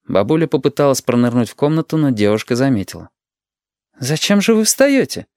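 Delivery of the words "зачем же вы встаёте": high-pitched reproachful question; high-voiced young doctor chiding; gentle reproach. A narrator's reading. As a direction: ask it chidingly, pitched well up. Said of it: "укоризненно спросила она".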